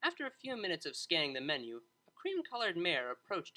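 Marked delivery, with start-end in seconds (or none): none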